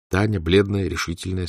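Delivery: usual speaking voice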